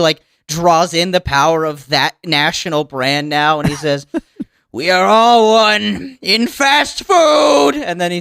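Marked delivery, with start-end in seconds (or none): 4.7-7.8 grand proclamation. Acted. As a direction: declare it like a crowned fast food king. Triumphant new CEO proclamation.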